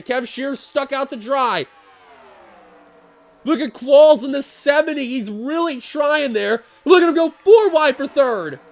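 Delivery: fast excited race commentary; excited race call. Real, not acted. Live race call at the finish.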